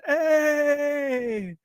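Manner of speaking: long, high-pitched drawn-out cheer; imitating an excited crowd greeting; mock stage cheer. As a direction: hold a high cheer on one word. Retelling band's entrance onstage.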